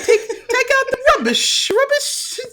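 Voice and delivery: very high-pitched mock British; bad British accent. Shown as a demonstration. Co-host retrying her British accent for friend, badly faked. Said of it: "I can't even do it".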